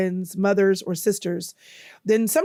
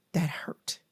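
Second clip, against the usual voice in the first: whispered admission; 3 semitones lower, 8 dB quieter than usual. Retold or imitated. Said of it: "I didn't wanna cry".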